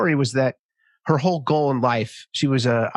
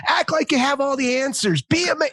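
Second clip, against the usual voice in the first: much higher hammed-up macho order; imitating Bill Burr; 11 semitones higher than usual. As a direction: play a comedian barking man-up advice. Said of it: "And he goes".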